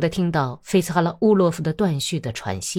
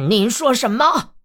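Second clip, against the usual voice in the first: high, raised cry of disbelief; hoarse outraged yell; 6 semitones higher, 4 dB louder than usual. A narrator's reading. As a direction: gasp with rage and yell it back. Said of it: "声音嘶哑地叫道".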